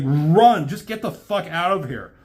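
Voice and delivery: loud high command to run; shouted order. Retold or imitated. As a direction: yell it at him. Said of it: "She's like".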